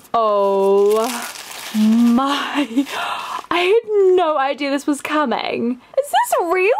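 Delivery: can't believe the gift is real; shocked delight. Real, not acted.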